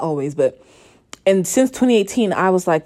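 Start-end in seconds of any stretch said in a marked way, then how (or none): none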